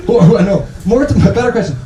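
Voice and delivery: usual speaking voice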